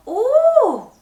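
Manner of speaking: a loud, high burst of wonder; amazed cry. Acted. Baby Charlie crying out in wonder at the full pot. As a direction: shout out in delight.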